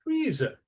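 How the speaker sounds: Freezer with a high, loud FREE; Freezer, strong start and falling end; stress on first syllable. Shown as a demonstration.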